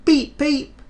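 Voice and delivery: very high, loud whistle; whistle beep. Acted. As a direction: toot like an engine. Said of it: "Thomas whistled".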